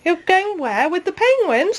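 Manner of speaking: ordinary talk, nothing marked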